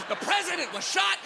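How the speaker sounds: high excited yell; kid's excited yell. Retold or imitated. Boy repeating news from the hedge, high excited cry. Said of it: "I was".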